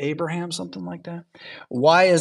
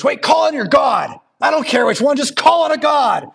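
high, loud mock-frantic yell; panicked shouting; 10 semitones higher, 5 dB louder than usual. Preacher imagines everyone at a shooting crying out, mock-frantic shout.

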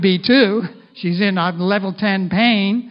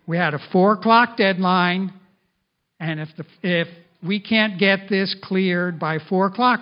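ordinary talk

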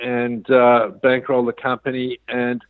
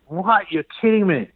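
high, startled disbelief; reliving the shock of the news; retold shock; 7 semitones higher than usual. Owner hearing the business was sold, a retold startled disbelief.

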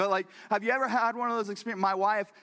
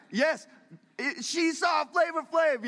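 excited shout; 9 semitones higher, 4 dB louder, slower than usual. Real, not acted. Excited confirmation to audience.

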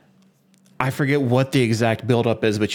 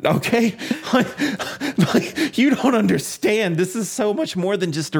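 much higher, laughing, worked-up protest; exasperated laughing protest; 11 semitones higher than usual. Retold or imitated. Defending his racing game to teasing friends, laughing protest. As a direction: plead your case through a laugh.

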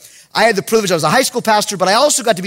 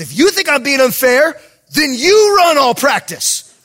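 raised, higher-pitched coach's order; angry command; 5 semitones higher, 3 dB louder than usual. Retold or imitated. Head coach to his challenging assistant, angry command. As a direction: lay down the punishment as the coach. Coach punishing pastor, acted.

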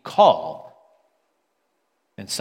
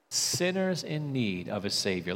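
soft echo; 3 semitones lower, 7 dB quieter than usual. Real, not acted. Pastor repeats the point to the church, softer and reflective. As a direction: repeat it softer, stress on Sinners. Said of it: "We have to remember".

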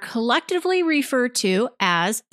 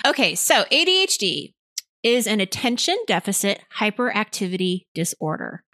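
ordinary delivery

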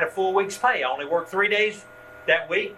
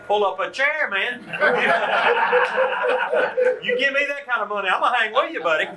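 laughing through the words, pitched up; 6 semitones higher, 4 dB louder than usual. Retold or imitated. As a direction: crack up while joking about the money. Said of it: "I'm thinking".

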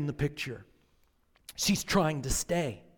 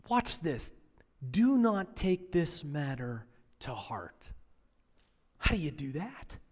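ordinary talk

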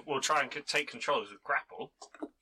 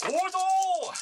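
shouted command; 6 dB louder than usual. Acted. Calling out spell word.

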